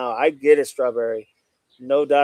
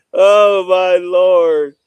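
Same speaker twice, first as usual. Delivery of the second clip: shocked outburst; 3 semitones higher, 8 dB louder, much slower than usual. Real, not acted. Reacts to a dark chat joke.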